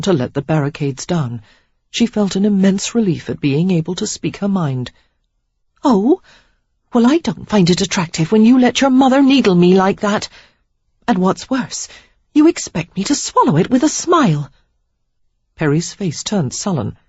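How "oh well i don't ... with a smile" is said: put-on young woman's voice, pitched up and heated; higher, louder reproach building to a burst; Sarah, indignant. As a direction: let her hurt boil over at him.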